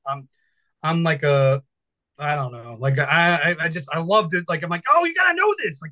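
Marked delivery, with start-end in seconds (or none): 4.9-5.8 high, excited burst of enthusiasm; high-pitched mock excitement; eager self-quote. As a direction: gush it like a nerd who can't wait to share. Quoting his eager self.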